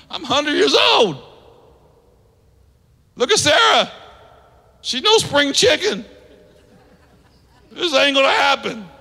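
put-on scoffing old Abraham. Abraham tells God he's too old, high mocking voice. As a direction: play Abraham, amused and doubtful.

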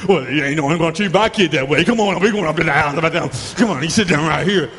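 mock angry mom; protective parent. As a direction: play the indignant parent.

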